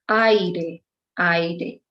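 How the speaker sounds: aire spoken as a-i-re, stress on a; both vowels sounded. Shown as a demonstration. Teacher demonstrates aire vowel by vowel. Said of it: "We pronounce both vowels".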